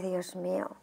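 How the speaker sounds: hushed, breathy prayer; whisper. Retold or imitated. Whispering a guilty prayer to God at dawn. Alone praying at dawn, ashamed.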